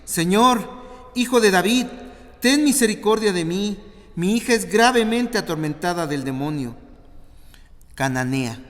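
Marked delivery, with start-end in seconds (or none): 0.0-6.8 high, loud desperate plea; a mother pleading loudly for her daughter; crying out. A narrator's reading. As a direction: beg for mercy at full voice. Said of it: "clamaba diciéndole".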